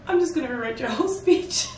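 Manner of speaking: lightly voiced helper, laughing and quick; quoting a helper. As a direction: mimic a breezy helper through a laugh. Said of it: "somebody goes".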